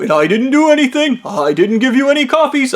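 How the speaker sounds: high, childish mock protest; hammed-up insistence on innocence; whiny denial. Manager denies giving the worker coffees, whiny protest.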